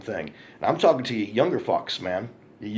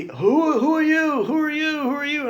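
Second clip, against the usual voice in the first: mock panicked guy, much higher; panicked naked guy; 3 dB louder than usual. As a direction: squeak it out like a startled man caught in the act.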